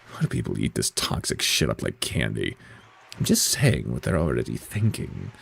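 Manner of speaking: quiet, deep-pitched disdain; low disgusted grumble. Acted. Other character reacts to show.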